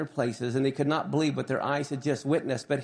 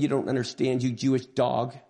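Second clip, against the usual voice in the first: ordinary talk, nothing marked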